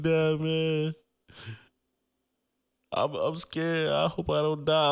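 high, drawn-out mock crying voice; whiny, high retold sob; imitating bawling. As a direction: re-enact a tearful plea to dad. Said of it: "I just started bawling all over".

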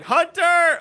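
loud, high call for a son. Retold or imitated. Dad calls for his son from the bathroom, a loud high shout. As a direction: yell the name across the house. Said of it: "I'm like".

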